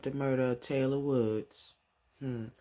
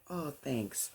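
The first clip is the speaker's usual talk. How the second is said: softer, higher polite thanks; 5 semitones higher, 5 dB quieter than usual. Retold or imitated. Leah thanking Jack for the compliment, very polite. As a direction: lightly imitate a courteous thank-you. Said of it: "very polite".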